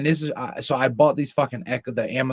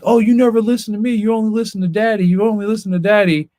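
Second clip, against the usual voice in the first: high, mocking imitation of a nagging parent; mock their griping in a raised voice; sing-song mock grievance; mimicked complaint; 8 semitones higher, 4 dB louder than usual. Mimics partner's complaint about listening.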